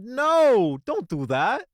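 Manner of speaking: sharp loud high no; emphatic no. Real, not acted. Telling viewers off a bad mixing habit, loud emphatic no. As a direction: shut the idea down loudly.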